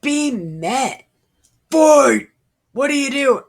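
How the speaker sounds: rally the troops with a mock war cry; rallying shout. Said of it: "the dude's like".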